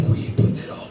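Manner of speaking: whispered disbelief; dad whispering amazed at his son's appetite; incredulous whisper. Retold or imitated. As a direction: hush it in disbelief. Asking son about eating.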